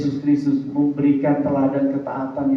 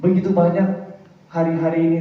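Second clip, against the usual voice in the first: ordinary talk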